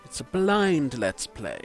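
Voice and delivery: ordinary talk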